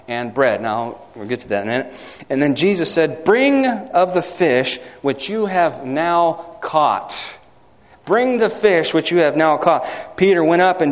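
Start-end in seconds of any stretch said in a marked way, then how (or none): 3.2-7.2 every word drawn out slowly; slow solemn scripture reading; slow, deliberate reading. Shown as a demonstration. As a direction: read the command slowly, weighing each word. Preacher reading Jesus' command slowly.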